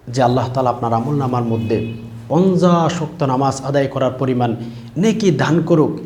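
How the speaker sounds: ordinary talk, nothing marked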